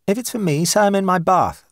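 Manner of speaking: a hint of a breezy wife; light woman's voice. A narrator's reading. Sybil voiced, higher.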